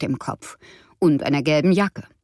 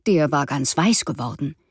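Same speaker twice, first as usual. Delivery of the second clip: plain reading voice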